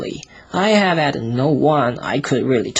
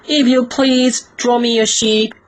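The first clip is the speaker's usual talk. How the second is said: put-on high, louder small boy voice; little boy's voice; 9 semitones higher, 3 dB louder than usual. Little prince waking the pilot, high childlike plea. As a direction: ask politely like a tiny strange child. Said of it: "Again he said".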